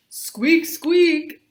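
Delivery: high, loud jeering squeak-squeak; mocking shout. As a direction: taunt her like a squeaky clarinet. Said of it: "someone shouted out".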